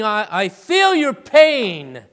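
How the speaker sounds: slow, loud, earnest voicing of Jesus; Jesus's empathy. Jesus voiced feeling the man's pain, slow and earnest. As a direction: lean in with heartfelt empathy. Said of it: "something saying".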